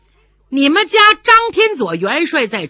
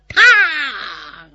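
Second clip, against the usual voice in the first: clang of steel; 5 semitones higher, 4 dB louder than usual. Acted. Blade clangs on spear.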